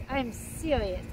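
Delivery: usual speaking voice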